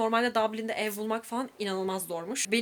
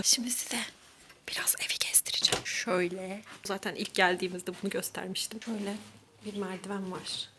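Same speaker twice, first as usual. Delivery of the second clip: whispering; 2 semitones lower, 7 dB quieter than usual. Real, not acted. Roommate next door might hear.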